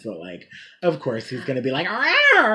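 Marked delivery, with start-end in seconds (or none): 1.9-2.6 high, loud imitated yowl; mock catty snarl. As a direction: let out a sassy mock "rawr". Imitating Elton being a saucy bitch.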